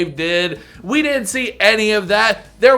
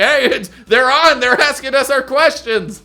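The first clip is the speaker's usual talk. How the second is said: excited call; 6 semitones higher, 4 dB louder than usual. Retold or imitated. Voicing excited partner.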